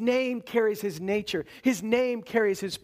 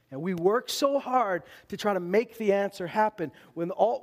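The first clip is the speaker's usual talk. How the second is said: normal talking voice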